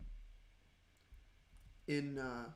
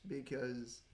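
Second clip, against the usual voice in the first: usual speaking voice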